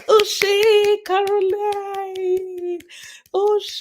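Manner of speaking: very high, long-held celebratory call; jubilant hailing. Real, not acted. Streamer hailing Caroline for a super chat, jubilant call. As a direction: sing out the praise, stretching each word.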